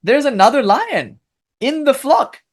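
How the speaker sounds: high astonished voice, pitched well up; a lion who can't believe his eyes, voiced high. Guru lion spotting a lion among sheep, astonished high voice. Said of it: "he suddenly noticed".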